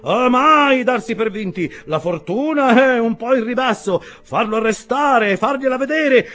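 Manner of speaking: high, loud stream of fragments; take-charge stranger holding forth loudly; voluble nonstop talker. A narrator's reading. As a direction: rattle off orders and reassurances to all around. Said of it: "dette con straordinaria volubilità".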